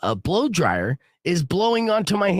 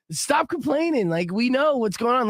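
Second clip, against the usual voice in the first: high, exasperated retort; retold late-night irritation; snapping irritably; 10 semitones higher than usual. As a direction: act out losing patience with a whiner. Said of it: "I'm snapping at her".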